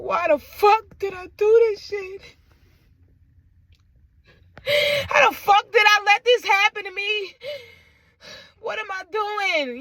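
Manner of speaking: very high, anguished cursing; frustrated despair. Retold or imitated. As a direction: wail the questions in frustration. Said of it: "I get so frustrated".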